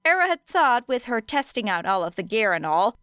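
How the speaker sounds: usual speaking voice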